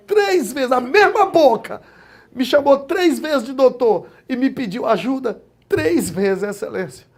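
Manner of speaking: emotional preacher proclaiming God's justice; preaching at full force. Acted. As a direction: build the testimony into a triumphant sermon. Preaching climax about God.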